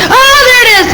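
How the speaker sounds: sudden high startled cry. Real, not acted. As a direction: blurt it out in alarm. Startled by the bird appearing.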